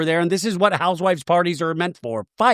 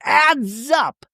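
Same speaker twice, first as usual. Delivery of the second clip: mock lecturing Shannon; 5 semitones higher than usual. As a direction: lecture her, pointing. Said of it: "Shannon, back to her, she's in full".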